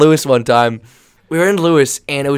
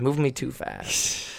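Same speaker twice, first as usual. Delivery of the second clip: much softer and slowed, under the breath; softly repeating the complaint to himself; quiet, slow repeat; 11 dB quieter, slower than usual. Real, not acted. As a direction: mutter it again to yourself. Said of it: "they're just moving me too fast".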